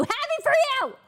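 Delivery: Happy for you! yelled high and loud; bitter shouted congratulations. Real, not acted. Streamer yelling mock congratulations at Ludwig.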